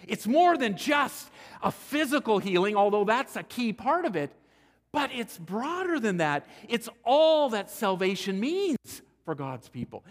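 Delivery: loud, high preaching peak; the preacher raises his voice to insist salvation is broader; preaching at full force. Acted. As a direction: drive it home from the pulpit.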